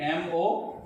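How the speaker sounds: ordinary delivery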